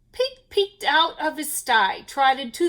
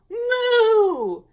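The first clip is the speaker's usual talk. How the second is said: hen mooing; 8 semitones higher, 6 dB louder than usual. A narrator's reading. Hen moos in alarm.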